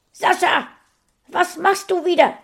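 hoch und laut, schimpfend; strenge Mutter. A narrator's reading. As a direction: ruf ihn scharf zur Ordnung. Mutter platzt herein. (laut schimpfende Mutter)

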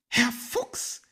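high, loud magpie calling for attention; pointed high-voiced magpie; nudging magpie. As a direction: call out high to the fox. Magpie nudges the fox.

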